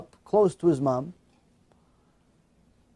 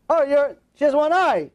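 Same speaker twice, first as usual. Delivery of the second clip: raised, mocking kid's taunt; schoolyard jeer; 6 semitones higher, 4 dB louder than usual. First graders mocking a boy's mother, raised jeer. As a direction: call it out high to make fun.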